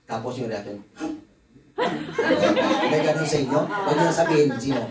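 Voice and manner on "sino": normal talking voice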